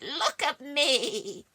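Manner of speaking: cackling, high, loud witch. A narrator's reading. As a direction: cast it like a gleeful witch. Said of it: "The witch said".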